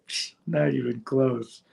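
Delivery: put-on dismissive British accent; scoffing Brit. A true Brit judging him, dismissive British accent. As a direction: scoff at the bad impression. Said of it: "a true Brit or a true Scotsman".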